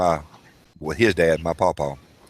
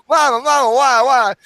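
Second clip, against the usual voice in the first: put-on high, loud childish wail; retold scream; 5 dB louder than usual. Kid screaming why at mama during the whooping. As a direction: plead with mama mid-whooping. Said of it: "I'm screaming like".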